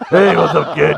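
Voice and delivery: smoker's voice. Retold or imitated. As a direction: rasp it like a chain-smoking toy bear. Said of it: "He had a smoker's voice".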